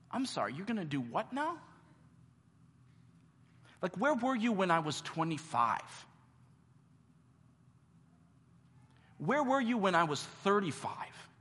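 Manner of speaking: sore, incredulous complaint; hurt disbelief. Retold or imitated. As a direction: throw the questions back, wounded. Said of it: "in Zechariah's words".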